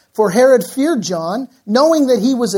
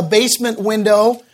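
ordinary talk

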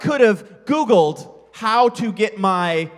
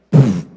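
loud dismissive puff of air; scoffing pfft; 10 semitones lower, 10 dB louder than usual. Retold or imitated. Dad scoffing at his son offering to help, a loud pfft. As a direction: blow it off with a sharp pfft.

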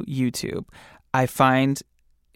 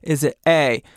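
usual speaking voice

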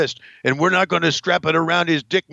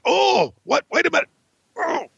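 mock surprise. Heel wrestler caught off guard by his opponent, high mock surprise. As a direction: act stunned mid-fight.

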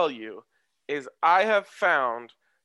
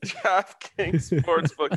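drawn-out announcer voice; 4 semitones higher, 3 dB louder, much slower than usual. Acted. Repeats sponsor name drawn out.

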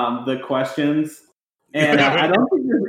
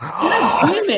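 exasperated shout; 13 semitones higher, slower than usual. Real, not acted. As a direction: shout it in mock despair.

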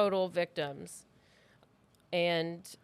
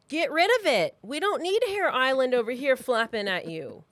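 louder, pushing hard on the order; exasperated rant; 3 semitones higher, 6 dB louder than usual. Real, not acted. Host ranting at listeners with patchy hair, exasperated and loud. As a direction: scold bald men to just get rid of it.

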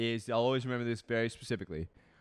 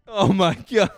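shocked; 7 semitones higher, 9 dB louder than usual. Real, not acted. Stunned by the stat.

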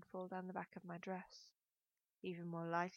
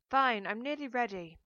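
louder, higher concession; exasperated call back; 4 semitones higher, 11 dB louder than usual. A narrator's reading. Daughter placates mother through the door, exasperated call. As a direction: call it out, giving in grudgingly.

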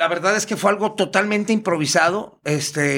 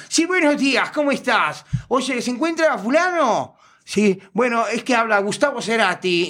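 high put-on phone greeting; obvious prank-call voice; 6 semitones higher than usual. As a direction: blatantly fake caller, pitched well up. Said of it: "lo hacía evidente que era una broma".

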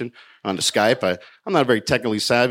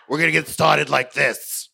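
mock tight-throated TV host, higher; a lightly voiced plastic Hollywood host; hammed-up perky host; fake host voice; 5 semitones higher, slower than usual. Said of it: "her voice sounded like there was plastic surgery in her throat".

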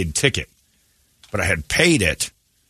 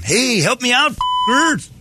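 hammed-up plea to the locals, pitched way up; mock Guadalupian; much slower than usual. Whitey cuffed in Guadalupe yelling for help, mock Guadalupian. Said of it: "start talking like a Guadalupian".

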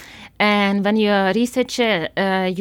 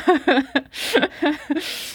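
real laugh; 6 semitones higher than usual. Real, not acted. Laughing at her name change.

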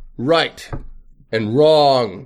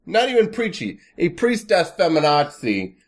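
ordinary delivery

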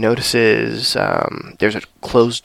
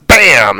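loud bam; mock bang; 7 dB louder than usual. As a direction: shout the door crash. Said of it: "but then he's like".

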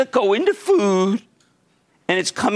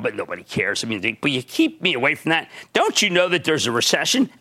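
ordinary talk

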